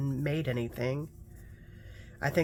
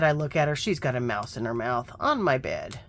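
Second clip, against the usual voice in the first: usual speaking voice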